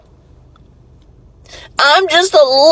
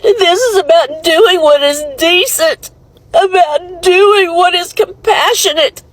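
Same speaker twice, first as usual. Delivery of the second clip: ugly crying. Real, not acted. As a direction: cry it out in anguish. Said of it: "I'm ugly crying on it".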